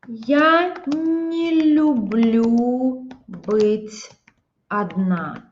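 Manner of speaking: я не люблю быть одна said very slowly; slow example sentence; slow demo. Shown as a demonstration. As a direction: stretch it out for the learners. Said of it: "Here in this case".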